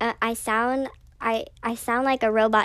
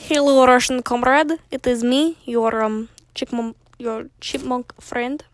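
put-on Russian accent; hammed-up Russian. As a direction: greet like a mock Russian comrade. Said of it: "we're going to try Russian next".

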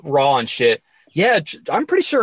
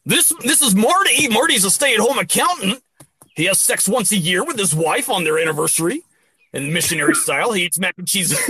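mock announcer intro; 5 semitones higher than usual. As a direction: introduce Marty like a game-show host.

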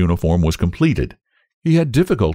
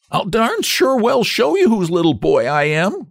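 put-on high, indignant boy's treble; ferocious retort; 13 semitones higher than usual. A narrator's reading. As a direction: fire back fiercely in a piping voice. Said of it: "in a ferocious voice".

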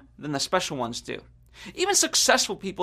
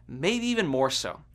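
normal talking voice

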